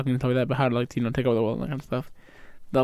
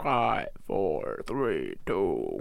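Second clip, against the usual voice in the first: lower, softer announcer countdown; dramatic countdown; 8 semitones lower, 8 dB quieter, much slower than usual. Retold or imitated. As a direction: count down like a hero show intro. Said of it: "they're like".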